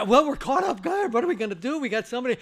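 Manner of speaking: high, loud flustered bosses; panicked bosses. Retold or imitated. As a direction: fret like you've been caught off guard. Bosses panicking after director walks out.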